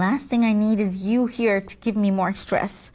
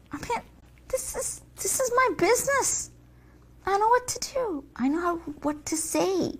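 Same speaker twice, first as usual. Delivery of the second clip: high, quiet fluster; flustered whine; 7 semitones higher, 4 dB quieter, faster than usual. Acted. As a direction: bluster defensively.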